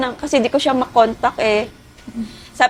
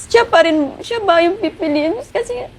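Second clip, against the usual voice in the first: hurt; 7 semitones higher than usual. Real, not acted. Jilted bride recounts heartbreak.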